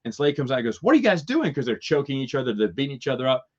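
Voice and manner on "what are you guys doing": high, loud startled shout at the brawlers; sudden raised call. Retold or imitated. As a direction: break up the fight. [name] breaks up the fight.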